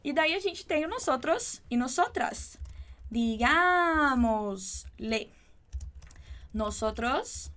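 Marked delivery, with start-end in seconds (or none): 3.1-4.5 "Digámosle" slow and loud, stress on the GÁ; teacher stressing the antepenultimate syllable; slow stressed demo. Shown as a demonstration. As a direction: drag out "Digámosle", punching the second syllable. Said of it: "por que que a gente tem aquele acento ali no digámosle".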